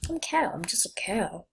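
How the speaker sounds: normal talking voice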